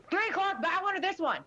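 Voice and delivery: high, loud eager "three o'clock"; loud, high, gleeful pointing out; excited callout. Retold or imitated. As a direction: call it out like spotting a crush. Calling out a hot person.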